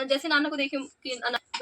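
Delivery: ordinary talk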